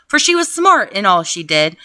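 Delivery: ordinary delivery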